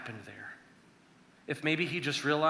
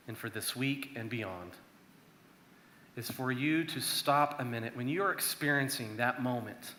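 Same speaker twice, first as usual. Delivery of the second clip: ordinary talk, nothing marked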